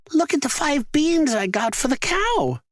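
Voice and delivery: put-on eager boy showing off his beans; young Jack. A narrator's reading. Jack showing his mother the beans, high boyish voice. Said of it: "Jack hurried home and said".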